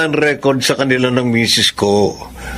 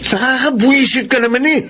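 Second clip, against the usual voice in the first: high, annoyed outburst at his wife; irritated husband; 10 semitones higher than usual. Acted. Husband scolding his wife over groceries, irritated. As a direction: snap at her in frustration.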